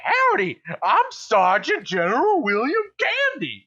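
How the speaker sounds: high, loud mock Texas drawl of Arnold Schwarzenegger; hammed-up Texan Schwarzenegger greeting; cowboy Schwarzenegger in a Texas accent. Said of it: "Arnold Schwarzenegger in a Texas accent".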